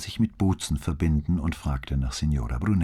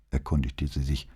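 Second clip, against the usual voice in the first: plain reading voice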